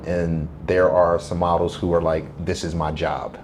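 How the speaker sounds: normal talking voice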